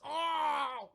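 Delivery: high, drawn-out "oh!"; cartoonish yell of a tumble; mock falling cry. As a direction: wail as if flung through the air. Imitates man flung off bike.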